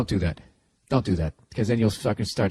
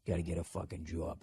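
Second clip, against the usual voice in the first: low, quiet Boston accent; 7 semitones lower, 11 dB quieter than usual. Shown as a demonstration. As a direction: say "job" as jaw plus b, Boston style. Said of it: "working on your Boston accents".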